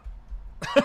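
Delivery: squeaky giggle, very high; high squeal laugh. Retold or imitated. High squeal after impression.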